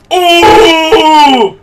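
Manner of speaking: long, high, loud "ooh"; drawn-out howl of amazement; over-the-top reaction scream. Retold or imitated. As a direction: re-enact a hysterical reaction, full volume. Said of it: "that reaction I had".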